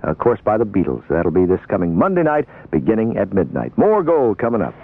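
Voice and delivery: ordinary talk, nothing marked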